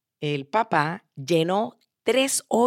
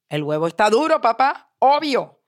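rising to a high, loud finish; emphatic, higher on the last words; stating the obvious; 4 semitones higher, 5 dB louder than usual. Acted. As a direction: daughter telling Dad the obvious. Daughter states the obvious to father.

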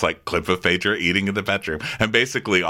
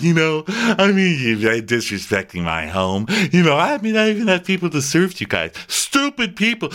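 mock tearful lecture; 7 semitones higher than usual. Host lecturing her guests, mock-indignant tirade. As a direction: imitate a wailing, indignant hostess. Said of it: "starts lecturing them and fake crying".

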